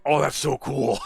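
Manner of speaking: high, bright-eyed wonder; excited gush. Retold or imitated. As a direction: gasp it out like a wowed kid. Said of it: "and I was like".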